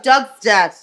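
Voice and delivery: chirpy nan, loud and high; flat repeat of bad news; blunt nan. Nan repeating the news down the phone, loud.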